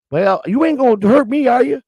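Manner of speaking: put-on high Southern old-lady voice, pleading a little. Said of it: "a nice old lady answered the door with a southern accent".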